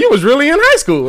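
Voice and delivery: high-pitched teasing disbelief; mock-incredulous, pitched well up; retold incredulity. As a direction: question it as if you can't believe it. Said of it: "I be like".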